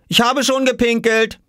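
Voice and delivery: put-on high, innocent boyish announcement; consoling call. A narrator's reading. Oskar telling his mother it is over, calling reassuringly. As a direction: call it down as good news. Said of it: "rief er, um sie zu trösten".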